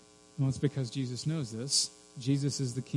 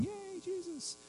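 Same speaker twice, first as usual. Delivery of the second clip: tiny, high, soft cheer; mock cheer; 14 dB quieter, slower than usual. Shown as a demonstration. Preacher contrasts demons with cheering fans, small mock cheer. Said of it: "they're not cheering".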